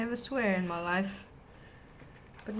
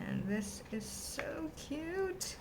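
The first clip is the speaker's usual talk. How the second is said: put-on high, slow baby-talk coo; cutesy voice; 9 semitones higher, 7 dB quieter, much slower than usual. Cooing over a sticker.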